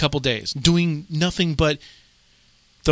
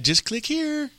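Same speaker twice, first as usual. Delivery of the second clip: put-on coaxing a child; 13 semitones higher, 4 dB quieter, slower than usual. Voicing herself guiding the niece.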